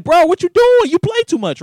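high, loud indignant yelp; outraged yelp. Retold or imitated. Startled friend yelling at the slapper, high and loud. Friend hit with a hard slap.

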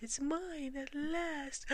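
high, slow whispery troll gloat. Acted. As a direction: gloat slowly like a greedy troll. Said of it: "Sid laughed".

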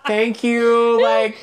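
a high, loud "Thank you"; a retold flustered thank you; embarrassed thanks. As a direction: squeak out thanks, mortified. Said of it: "I'm like".

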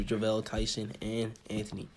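ordinary delivery

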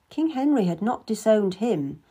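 ordinary delivery

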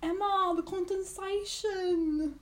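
slow, high bad Australian accent. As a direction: drag it out in a bad Aussie accent. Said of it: "I was really bad at Australian accent".

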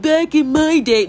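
lightly voiced old man reminiscing; old grandpa. Imitating Britain as an old grandpa, lightly voiced. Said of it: "like, the old grandpa".

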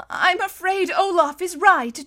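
high, slow, regretful admission; tearful. A narrator's reading. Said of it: "said through her tears".

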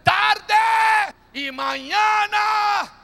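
slow, high, loud proclamation; booming congregational shout. Acted. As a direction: call it out slowly for the whole church. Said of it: "Alguien diga más fuerte".

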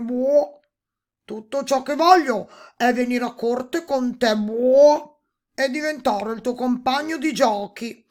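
high, loud toad with croaks; hammed-up toad voice, loud and insistent; demanding toad. A narrator's reading. Toad refusing riches and asking for companionship, demanding croaky voice.

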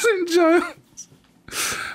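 stretched-out high squeak; drawn-out high echo. Real, not acted. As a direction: draw it out high and slow.

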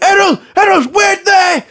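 high, drawn-out imitated shout; mock shrieking dog owner; woman calling her dog. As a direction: holler after a runaway dog, hammed up. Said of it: "she went".

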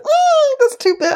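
very high-pitched squeamish mum; imitated high-voiced recoil; mock disgust. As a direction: squeal it like mum tasting something nasty. Mum tastes wine, dislikes it.

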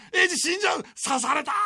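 high, frantic mock panic; panicked cry. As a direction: cry out as if he is dying. Drunk friends panicking at TV. (慌てた叫び)

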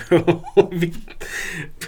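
high-pitched falling laugh; real laughter. Real, not acted. Co-host amused by the file name, real laughter. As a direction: laugh at what you just read.

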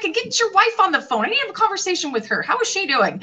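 high, animated demand; hammed-up insistent telling-off; mock confrontation. Acted confrontation with client.

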